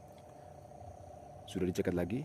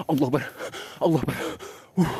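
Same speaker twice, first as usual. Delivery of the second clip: loud, higher cry of fear; panicked cry; 7 semitones higher, 11 dB louder, faster than usual. Real, not acted. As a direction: cry out to God. Panic grows.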